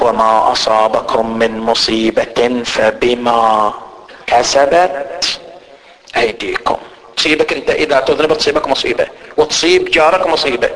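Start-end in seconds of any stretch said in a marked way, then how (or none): 0.0-6.8 slow, low, drawn-out recitation; Quran recitation. Acted. Preacher reciting a verse to the congregation, slow and solemn. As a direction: intone the verse slowly in a low voice.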